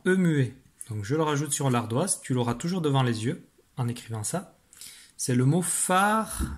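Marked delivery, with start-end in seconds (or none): 5.6-6.5 higher, spaced-out dictation; syllable by syllable. Shown as a demonstration. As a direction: announce the word in three separate, lengthened syllables. Dictée du mot par syllabes. (dictée syllabe par syllabe, voix plus haute)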